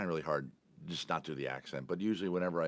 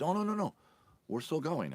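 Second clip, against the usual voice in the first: high-pitched imitated protest, easing down; insistent reassurance; 12 semitones higher, 3 dB louder than usual. Driver telling passenger the ride is still on, insistent. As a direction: wave off the worry in a raised voice.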